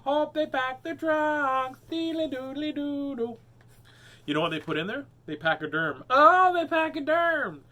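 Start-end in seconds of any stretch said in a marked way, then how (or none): 0.0-3.4 silly repeat of a pun. Pretend. 6.1-7.6 silly punchline. Pretend.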